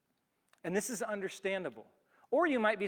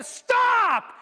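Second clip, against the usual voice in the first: loud scream, much higher; 13 dB louder than usual. Retold or imitated. Cyclist screams at an oncoming car, loud scream. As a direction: yell it to stop the car. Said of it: "We're, like, screaming at them".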